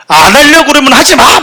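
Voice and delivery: high, loud don't-bother shout; loud angry order. Retold or imitated. Student leader scolding a cast member, loud angry shout. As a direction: snap it at the quitter with full force. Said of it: "막 이게 되게 컸어요".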